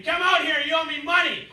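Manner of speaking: very high mock scream; imitated screaming. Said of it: "He's screaming".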